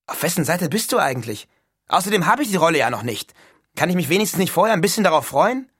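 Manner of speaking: exasperated Neil. A narrator's reading. Neil reproaches his doubting roommate, exasperated. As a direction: protest with rising, quickening frustration.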